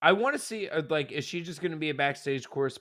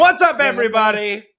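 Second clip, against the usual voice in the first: loud, high host greeting; hyped-up host shout; hype shout; 8 semitones higher, 11 dB louder than usual. Acted. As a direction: shout it to open the show. Host hyping show opening.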